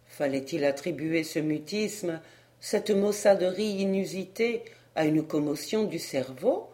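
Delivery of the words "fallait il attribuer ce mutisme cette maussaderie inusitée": ordinary delivery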